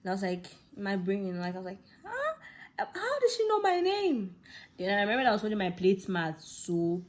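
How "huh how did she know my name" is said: high, slow disbelieving whimper; baffled squeak of a new girl; high startled wail; shocked. Retold or imitated. Shocked at being named.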